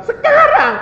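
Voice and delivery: put-on high, loud childish protest; stubborn demand. Believer refusing God's delay, high and stubborn. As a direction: insist loudly, pitched right up.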